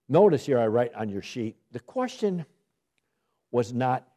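normal talking voice

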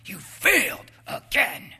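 high, loud, barking demand to perform; nagging voice shouting. Retold or imitated. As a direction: yell it like a relentless taskmaster. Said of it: "The flesh would put you back in bondage".

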